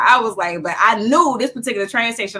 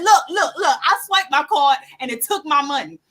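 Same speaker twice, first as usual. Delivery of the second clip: high-pitched, urgent arguing; retold insistent protest; 8 semitones higher, 5 dB louder than usual. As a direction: argue your case to the officer, half laughing.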